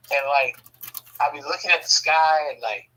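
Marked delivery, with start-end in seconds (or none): none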